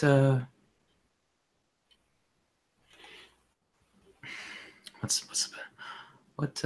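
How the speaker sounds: quiet, hesitant question with long pauses; speaking quietly. Acted. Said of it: "she's speaking pretty quietly".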